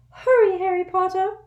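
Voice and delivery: urgent little servant squeaking; an anxious house elf waking his master; squeaky house elf. A narrator's reading. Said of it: "squeaked Dobby".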